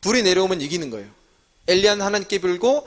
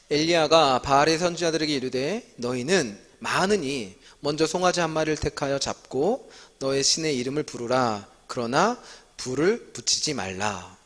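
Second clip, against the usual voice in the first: measured, slightly slower Bible reading; scripture reading; 4 semitones lower, 3 dB quieter than usual. Acted. Teacher reading 1 Kings aloud to the class, steady scripture reading. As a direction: read the passage out evenly, like a responsive reading.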